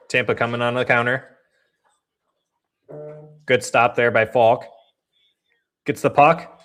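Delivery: live game call, even and steady; casual hockey commentary; play-by-play. Acted. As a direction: call the puck as it moves.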